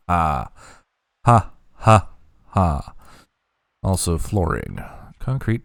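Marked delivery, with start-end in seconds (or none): 1.2-2.1 mad scientist laugh. Pretend. Evil laugh continues.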